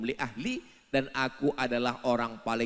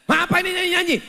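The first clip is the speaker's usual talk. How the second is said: high, loud outraged question; angry husband; 13 dB louder, much faster than usual. Acted. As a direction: shout at the singing. Husband angered by the song. (suami membentak marah)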